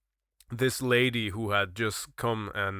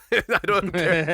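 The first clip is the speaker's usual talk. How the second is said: laughing through the words, a little slower; 6 semitones higher, 3 dB louder, slower than usual. Real, not acted. Recalling telling a coworker off, laughing. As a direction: chuckle it out, amused at yourself. Laughing at his own retold snub.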